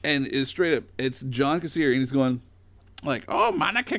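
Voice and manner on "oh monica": much higher ghoulish cackling host voice; imitating the Crypt Keeper's voicemail greeting. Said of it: "I can't do the voice".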